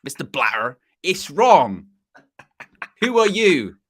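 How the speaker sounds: Bristolian protest, slow at the end; Bristolian accent. Retold or imitated. Pundit showing he can do Bristolian, Bristolian accent. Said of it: "like, a Bristolian".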